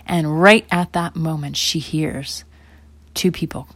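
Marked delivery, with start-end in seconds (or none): none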